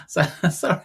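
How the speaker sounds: high, breathy laugh through tears; real laugh fighting back emotion; emotional laugh. Real, not acted. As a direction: laugh off being choked up. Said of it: "I'm trying not to get emotional".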